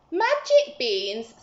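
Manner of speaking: high, loud, slow question; can't believe his ears; boy hearing about magic beans; amazed Jack. Acted. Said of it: "said Jack".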